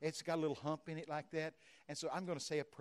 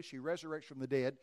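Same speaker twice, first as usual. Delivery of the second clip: usual speaking voice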